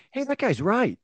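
high-pitched mock shout without the volume; mock crowd heckler. Co-host voicing a guy in The Simpsons crowd, mock shout. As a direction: imitate a lone cartoon crowd voice piping up. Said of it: "that shouts something that goes".

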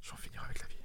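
whispered mock despair; joking whisper. Host muttering a fake threat during banter, whispered. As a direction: breathe it out as a throwaway joke.

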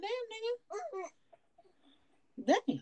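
high slow drawn-out disbelief; stunned. Retold or imitated. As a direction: stretch it out, high and amazed. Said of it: "I'm like".

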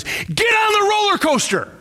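big shout of a conference opener; shouted line. Retold or imitated. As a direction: belt it out like an opening call. Preacher quotes speaker's opening shout.

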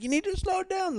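high, mocking yell of the go-kart man; mock Thai yell. Retelling how the go-kart worker yelled, high and mocking. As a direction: squeak the warning out high, mocking him. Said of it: "the dude starts yelling at me in fucking Thai".

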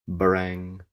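loud, sustained imitation of a bell; bell clang. A narrator's reading. Lackey rings his bell behind them, clanging. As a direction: ring the word out on a held note. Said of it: "The lackey rang his bell".

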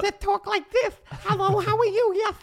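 extremely high squeaky lisp; lisping, high-pitched voice. Acted. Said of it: "he's got a lisp and a high-pitched voice".

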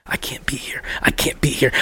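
imitating a scared friend in a whisper; voiceless, hushed retold plea; panicked whisper. Courtney too high, panicking, retold.

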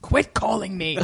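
Liam Neeson. Retold or imitated. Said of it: "And Liam was like".

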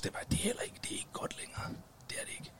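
hushed unvoiced whisper; whispered gossip. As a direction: whisper it like a secret. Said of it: "whispers verdict on [name]".

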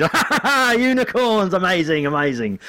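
very high laughing burst, easing down; gleeful laugh. Retold or imitated. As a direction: cackle with delight then calm to amazed. Comedian sees unicorn onesies.

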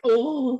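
high, loud hammed-up Oh of collapse; theatrical mock death cry; amdram groan. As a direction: overplay the knockout like bad stage acting. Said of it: "really sort of amdram".

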